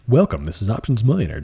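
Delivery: ordinary talk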